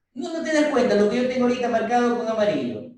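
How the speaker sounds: high, loud, fast demonstration; emphatic. Shown as a demonstration. Teacher showing the highlighted slide to students, high-pitched and loud. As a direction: push it out high and urgent. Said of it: "así".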